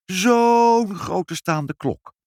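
high, long drawn-out first word; marvelling. Acted. Mother describing the baron's clock to her child, marvelling. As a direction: stretch it out to show enormous size.

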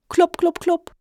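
high, loud spoken knocks; knocking sounds. Pretend. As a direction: voice the knocks on the door. Imitating knocks on door.

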